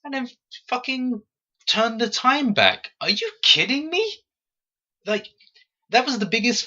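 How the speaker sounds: high-pitched incredulous rant; exasperated disbelief. Real, not acted. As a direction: pitch jumps way up in outrage. Ranting at the time-reversal twist.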